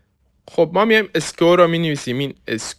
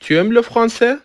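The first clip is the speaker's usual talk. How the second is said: "Tu aimes le français" asked on a high pitch, held up to the end; rising question intonation; 8 semitones higher, 3 dB louder, slower than usual. Shown as a demonstration. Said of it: "با تغییر تون صدامون".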